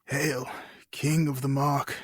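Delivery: a dying king's last breath of words. Acted. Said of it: "he said".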